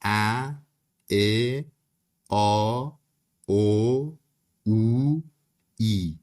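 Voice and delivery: each Turkish vowel said alone, clearly; vowel sounds. Shown as a demonstration. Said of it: "حروف مصوت یعنی صدادار مثل".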